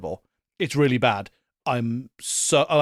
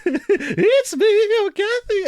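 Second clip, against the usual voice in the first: very high, loud mock wail; hammed-up lovelorn howl; 5 dB louder, much slower than usual. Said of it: "channeling that [name] octave".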